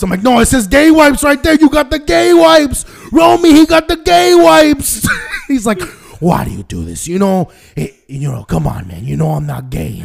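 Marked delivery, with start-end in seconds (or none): 0.2-5.1 much higher and louder sing-song teasing; loud mock taunt. Son teasing dad about wipes, loud and higher. 6.2-10.0 lower, plaintive protest; lowered defeated dad. Retold or imitated. Dad protesting to his son, lower and weary.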